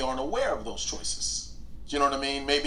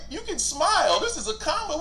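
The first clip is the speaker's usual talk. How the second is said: teasing plea; 14 semitones higher, 3 dB louder than usual. Retold or imitated. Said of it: "I'm like".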